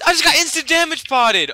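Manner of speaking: screaming in disbelief; shocked yell. Real, not acted. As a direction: yell it out, stunned. Sudden in-game shock.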